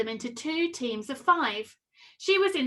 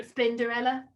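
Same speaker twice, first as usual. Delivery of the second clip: ordinary delivery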